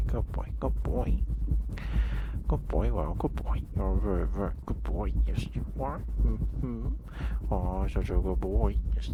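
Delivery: shower the dog with happy praise; gushing pet praise. Real, not acted. Owner gushing over her good boy dog, loud and excited.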